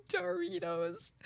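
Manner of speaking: slow, breathy, teary with laughter; laughing. Retold or imitated. Retelling the friend's mistake, crying with laughter. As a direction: squeeze it out through tears of laughter. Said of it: "I was like".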